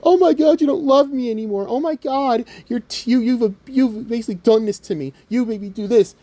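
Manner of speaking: high-pitched mock heartbreak, easing down slightly; whiny, accusing put-on voice; mock whiny complainer. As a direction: imitate a betrayed lover, hammed up. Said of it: "this is how I'm gonna translate it for them".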